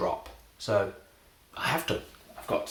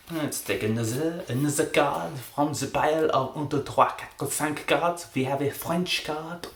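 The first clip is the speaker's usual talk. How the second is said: higher-pitched mock French accent; 5 semitones higher than usual. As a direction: patter along in an attempted French accent. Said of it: "I will be doing a French accent".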